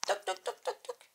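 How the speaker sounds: quick high, soft "toc" noises; imitated running footsteps. Vlogger running away from viewers, imitated footsteps. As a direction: patter out little running steps by mouth. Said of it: "C'est moi qui cours".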